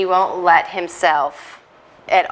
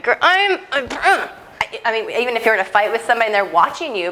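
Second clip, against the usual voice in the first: normal talking voice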